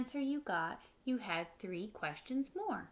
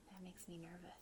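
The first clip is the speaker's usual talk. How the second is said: unvoiced whispered aside; hushed nervous aside; 3 semitones lower, 16 dB quieter, much faster than usual. Real, not acted. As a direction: lean in and whisper it. Aside to listeners.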